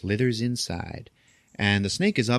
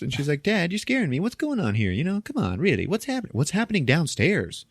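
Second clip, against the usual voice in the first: put-on high girlish voice, worried; mock daughter pleading in a higher voice; scared daughter; 7 semitones higher than usual. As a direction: play the frightened teen daughter. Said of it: "His daughter is like".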